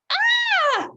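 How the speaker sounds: high, loud startled yelp. A narrator's reading. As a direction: jump back with a sharp high cry. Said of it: "yelped Jack and Annie together".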